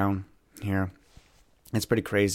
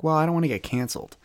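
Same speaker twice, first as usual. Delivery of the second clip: wary excuse; 7 semitones higher, faster than usual. Retold or imitated. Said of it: "And I'm like".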